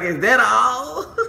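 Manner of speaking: high, slow, half-laughing letdown; slow, high, laughing "is that all"; disappointed disbelief. Retold or imitated. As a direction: drag it out, laughing at the anticlimax. Let down, no ring.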